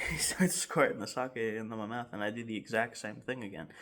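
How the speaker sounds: ordinary delivery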